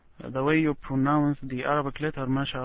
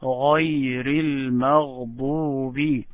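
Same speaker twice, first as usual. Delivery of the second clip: Quranic recitation. Shown as a demonstration.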